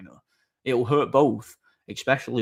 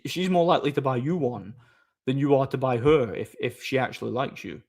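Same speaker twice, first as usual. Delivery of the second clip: usual speaking voice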